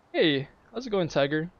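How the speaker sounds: ordinary talk, nothing marked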